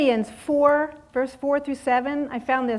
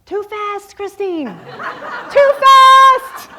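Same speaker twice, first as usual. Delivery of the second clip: high, loud retold yell; yelled warning; 10 semitones higher, 14 dB louder, much slower than usual. Ski instructor yells a warning at a speeding Christine. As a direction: shout the warning across the slope. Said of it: "I hear him yell".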